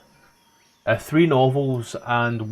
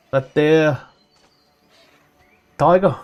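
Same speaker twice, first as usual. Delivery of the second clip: put-on South African accent; 3 semitones higher than usual. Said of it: "Why does he sound like New Zealand".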